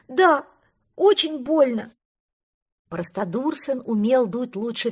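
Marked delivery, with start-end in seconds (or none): 0.1-1.9 higher, louder whining; blubbering. Acted. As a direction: blubber about the pain. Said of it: "подвывал он".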